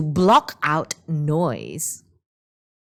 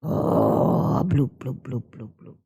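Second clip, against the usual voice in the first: sinking bloops; 5 semitones lower than usual. Acted. Nian sinks under sea.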